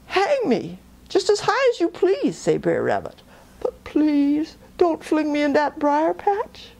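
pleading rabbit. Acted.

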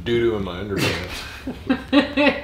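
put-on low, slow dopey puppet kid; Jeffy voice.

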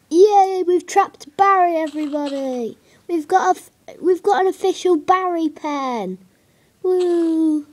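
high loud celebrating; excited cheer. Real, not acted. Celebrating the finished pen for viewers, excited. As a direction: cheer it to everyone.

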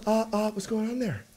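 Jason Bateman. Acted. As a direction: check on someone as if a step ahead. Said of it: "you're doing a legit Jason Bateman".

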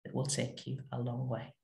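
ordinary talk, nothing marked

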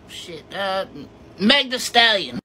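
usual speaking voice

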